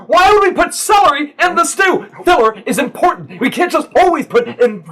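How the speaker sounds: high, loud squabbling cook voices; hammed-up kitchen quarrel between brothers; bickering cooks. Two cooks bickering over soup.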